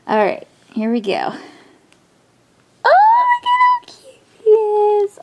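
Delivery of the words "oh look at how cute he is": much higher, louder crying squeal; squealing coo. Real, not acted. First seeing her new baby's face, overjoyed squeal. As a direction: squeal with delight at his face.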